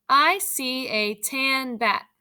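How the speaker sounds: put-on slow, word by word for young readers; slow read-aloud. Shown as a demonstration. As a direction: read it out slowly so kids can follow. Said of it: "I'm going to read them to you".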